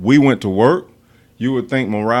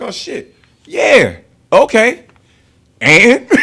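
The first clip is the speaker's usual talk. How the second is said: much higher and louder, laughing on the last word; mock dismissive shrug; 12 semitones higher, 12 dB louder, much slower than usual. Brushing off a sergeant who hates him, loud and mocking. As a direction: toss it off like nothing matters. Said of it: "it's like".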